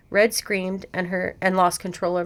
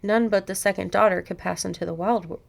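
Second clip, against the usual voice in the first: ordinary delivery, nothing marked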